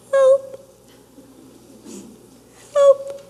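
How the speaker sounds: high, small, pleading voice; a tiny trapped voice begging for rescue; little tiny voice. Retold or imitated. The hidden self under the covers begging someone to save her, in a tiny high voice. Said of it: "Me is this little tiny voice".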